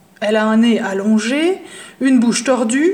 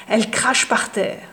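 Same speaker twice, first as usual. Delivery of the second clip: ordinary delivery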